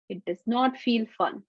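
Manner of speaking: ordinary delivery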